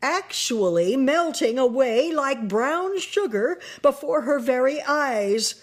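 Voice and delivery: ordinary delivery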